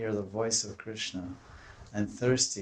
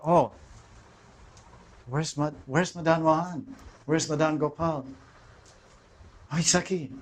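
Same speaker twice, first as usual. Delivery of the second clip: searching lament; 6 semitones higher than usual. Retold or imitated. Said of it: "she comes out".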